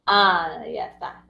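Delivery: normal talking voice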